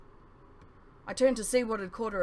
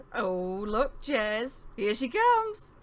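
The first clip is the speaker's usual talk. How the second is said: rising higher and louder to an excited, slow "here she comes"; excited teasing; 4 semitones higher, 5 dB louder, much slower than usual. Acted. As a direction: nudge your friend, thrilled. Friend spots the queen, excited.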